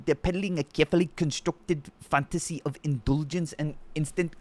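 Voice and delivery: mocking South African accent reading text. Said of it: "South Africa is such a funny accent".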